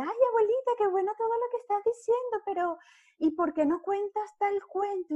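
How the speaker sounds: put-on a girl nudging grandma with a sugary high voice; imitating the young actress. Young actress prompting the forgetful grandma onstage, high sweet voice. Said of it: "Y ella".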